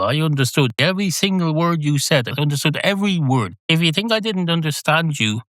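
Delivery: affronted cat, pitch lifted; indignant. Acted. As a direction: protest that you followed it all perfectly. Cat indignantly insists he understood.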